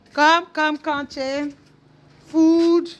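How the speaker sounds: very high, loud coaxing voice; pet-talk voice. Real, not acted. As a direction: coax the dog in a sing-song.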